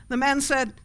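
ordinary delivery

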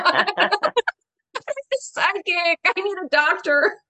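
mock-whine the need for a doctor; exasperated whining to herself; whiny protest. Inner protest at being sent to psychic.

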